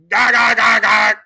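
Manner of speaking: very loud, high mock cackle; harsh repeated cackle, hammed up; Kamala cackle. Cackling doll going off, blaring mock cackle. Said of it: "you have the cackling Kamala".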